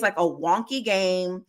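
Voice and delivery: usual speaking voice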